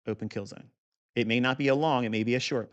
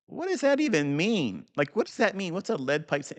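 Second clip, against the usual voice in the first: puzzled younger self; 7 semitones higher than usual. Retold or imitated. Recalling reading Larry Williams, high puzzled mock question. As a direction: play your confused past self reading the book. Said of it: "I'm like".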